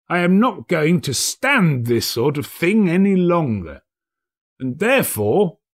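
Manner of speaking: high mock-stern echo; feigned stern Scrooge. A narrator's reading. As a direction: pretend to be outraged.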